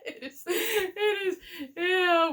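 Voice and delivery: helpless, high-pitched laughing agreement. Real, not acted. Vlogger amused by a bad Cockney accent, laughing. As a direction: talk through real laughter, pitched high.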